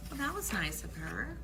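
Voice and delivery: plain reading voice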